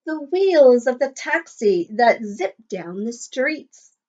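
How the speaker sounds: lilting, sing-song picture book read; reading to children. Acted. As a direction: read the rhyme aloud to a class. Reading rhyme to class.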